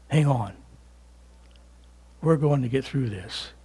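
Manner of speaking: soft, low, calming voice; hushed encouragement with a long pause between phrases; near-whispered reassurance. Acted. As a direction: quietly comfort someone held close. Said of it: "he's whispering to you".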